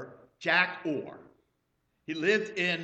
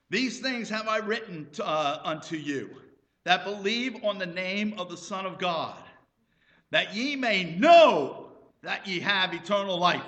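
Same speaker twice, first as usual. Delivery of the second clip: scripture reading; 3 semitones higher, 7 dB louder than usual. Acted.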